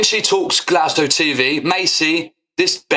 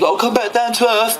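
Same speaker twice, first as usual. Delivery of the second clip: mocking quote; 7 semitones higher than usual. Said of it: "Positive Dan".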